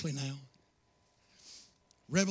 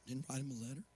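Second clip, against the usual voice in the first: much softer, lower reply; quiet answer; 5 semitones lower, 16 dB quieter, faster than usual. Acted. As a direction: drop it down to nearly nothing. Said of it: "quiet answer after question".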